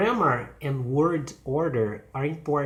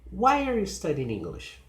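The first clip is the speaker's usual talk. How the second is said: high "Why", descending to "English"; falling question; 5 semitones higher than usual. Shown as a demonstration. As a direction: stress "Why", slide down after it. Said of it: "they use falling intonation".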